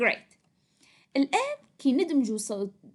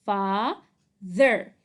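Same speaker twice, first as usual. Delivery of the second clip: Father split slowly and loudly into fa... ther; sounding out; 6 dB louder than usual. Shown as a demonstration. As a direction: read Father out syllable by syllable. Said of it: "Spell and read".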